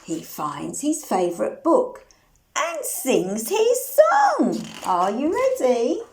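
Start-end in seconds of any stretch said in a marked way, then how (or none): none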